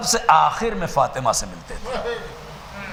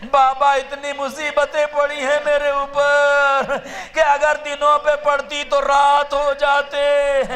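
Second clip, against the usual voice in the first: raised, slowed lament; mournful preaching; 7 semitones higher, slower than usual. Acted. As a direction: grieve aloud for the listeners. Lamenting Fatima's sufferings. (بلند آواز میں سوگوار بیان)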